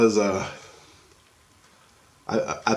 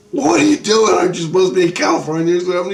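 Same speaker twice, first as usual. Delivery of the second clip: high, louder, fast disbelieving question; baffled question; 7 semitones higher, 5 dB louder, faster than usual. Retold or imitated. Asking Rusty why he isn't in California, high and fast. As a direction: rattle it off in disbelief. Confronting Rusty who should be away.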